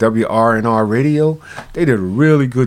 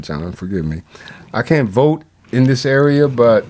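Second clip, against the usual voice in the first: ordinary talk, nothing marked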